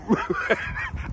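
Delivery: breathy laugh. Real, not acted. Laughing at own story.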